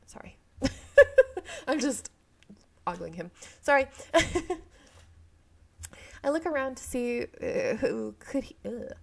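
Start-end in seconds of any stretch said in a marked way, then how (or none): 0.6-1.6 high loud embarrassed laugh; burst of real laughter. Real, not acted. Laughing at her own ogling. 3.9-4.6 short real laugh, louder and higher; embarrassed giggle. Real, not acted. Laughing after apologizing.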